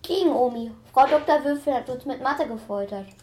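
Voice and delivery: loud, fed-up grumble; loud complaint. Acted. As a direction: complain loudly about school. Boy complains loudly.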